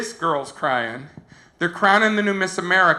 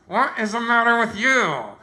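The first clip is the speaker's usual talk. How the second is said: higher-pitched question through a smile; smiling contestant; 5 semitones higher than usual. Retold or imitated. Smiling contestant leans over to the crying girl, high smiling voice. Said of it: "smiling, and she leans over".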